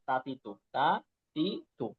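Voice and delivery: তাতি তু slow and light, like Bangla ত; thin ta demo. Shown as a demonstration. Teacher slowly contrasts thin তাতি তু for students. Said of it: "Bangla তয়কা তার মতো".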